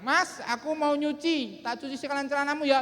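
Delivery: high, drawn-out wifely call; acted wife's high-pitched holler. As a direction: call across the house to your husband. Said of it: "istrinya teriak".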